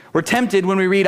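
normal talking voice

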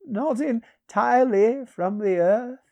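high, slow reply with a wry smile. A narrator's reading. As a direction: answer drily in a high, drawn-out voice. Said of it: "answered Peleus with a wry smile".

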